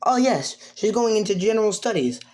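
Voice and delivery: high-pitched, quick official; high, busy character voice; Nezu. Acted. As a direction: hurry through it in a high voice. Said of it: "and he says".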